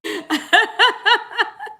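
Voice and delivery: high-pitched helpless laugh; laughter. Real, not acted. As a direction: burst out in a long, high laugh. Laughs at herself.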